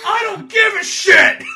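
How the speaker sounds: high, loud laughing yell; hammed-up New York defiance; mock defiant shout. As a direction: scream it in their faces. Kaz defying hijackers.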